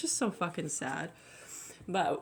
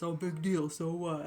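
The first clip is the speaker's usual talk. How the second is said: dismissive mock. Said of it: "someone just".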